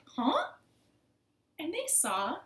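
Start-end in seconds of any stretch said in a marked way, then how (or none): 0.1-0.5 high, loud questioning huh; perky storybook double take; puzzled frogs. Acted. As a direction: react with startled curiosity.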